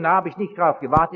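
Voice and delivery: ordinary talk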